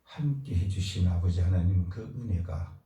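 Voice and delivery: ordinary delivery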